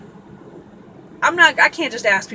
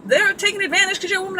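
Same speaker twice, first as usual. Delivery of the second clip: much higher, rushed mock yell; imitating a yelling friend; 10 semitones higher, faster than usual. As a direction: fire it off like an outraged friend. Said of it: "I felt like he was yelling at me".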